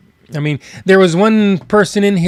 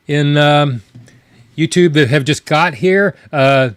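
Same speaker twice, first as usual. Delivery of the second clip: ordinary delivery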